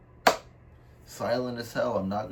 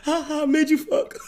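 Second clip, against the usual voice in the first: high, laughing streamer voice, pitched way up; Kai Cenat impression; 6 dB louder than usual. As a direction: cackle the line like a hyped streamer. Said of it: "I'm about to put it on right here".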